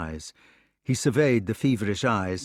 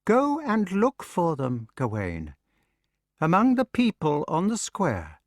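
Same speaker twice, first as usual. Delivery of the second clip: taunting; 7 semitones higher than usual. Acted. Mordred taunts brother.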